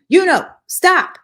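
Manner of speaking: high, loud yell. A narrator's reading. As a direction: bark it out at full volume. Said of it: "bark of San yelling".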